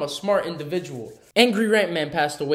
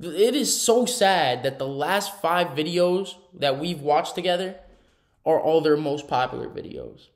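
normal talking voice

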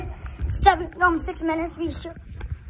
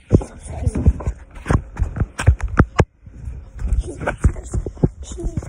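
whispering; 3 dB louder than usual. Real, not acted. Whispering location while hiding.

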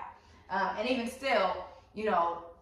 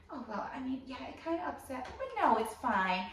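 soft, slightly raised wounded voice; passive-aggressive; 3 semitones higher, 5 dB quieter than usual. Acted. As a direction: play it hurt but holding back. Voices annoying passive-aggressive people.

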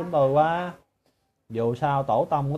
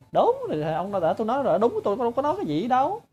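mock denial; 9 semitones higher, faster than usual. Mocking an opponent's denial.

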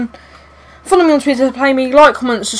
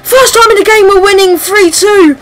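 much higher and louder, screaming with joy; ecstatic screaming; 9 semitones higher, 4 dB louder than usual. Real, not acted. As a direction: scream the lead goal in pure delight. Winning goal, ecstatic screaming.

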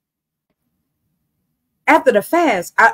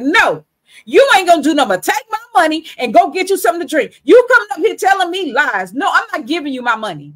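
raised, fast, heated no to a beggar; loud refusal; 10 semitones higher, 7 dB louder than usual. Retold or imitated. Turning down a man asking for money, raised and heated. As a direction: snap back hard, voice up high.

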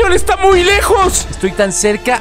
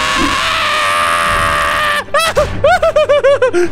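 screaming laugh; 9 semitones higher than usual. Real, not acted. Fleeing mob, wild laughter.

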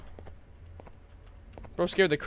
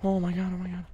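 soft, fading exclamation; shaken aside; 9 dB quieter than usual. Real, not acted. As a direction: let it fade away under your voice. Recovering from the scare.

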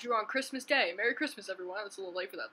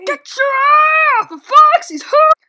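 very high, very loud yell; full shout; 23 dB louder than usual. Acted. Yelling to protect Foxy's hook, high full-force shout. Acted yelled retort.

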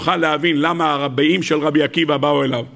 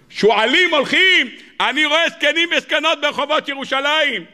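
impassioned preaching; 10 semitones higher than usual. Acted. Preacher telling his congregation God promises salvation, impassioned. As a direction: preach it high and intense, stretching the key words.

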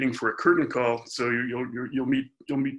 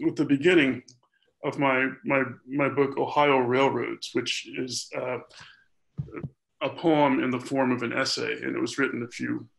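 ordinary talk

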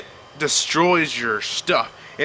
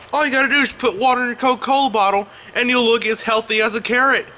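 normal talking voice

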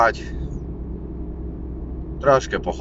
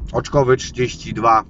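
ordinary talk

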